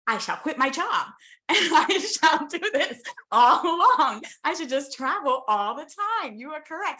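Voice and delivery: laughing, hammed-up grand declaration; mock agreement. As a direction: play it overly solemn to twist his words. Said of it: "That's not what I was saying".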